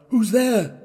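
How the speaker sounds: put-on old man's high, loud startled cry; frightened cry. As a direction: cry out in sudden fear from the bed. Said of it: "crying out".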